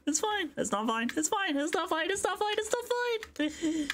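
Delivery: falsetto back and forth, it's fine, it's not fine; high mock-frantic voice; high squeaky panic. High squeaky self-argument.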